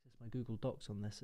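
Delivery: ordinary talk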